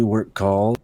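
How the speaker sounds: ordinary delivery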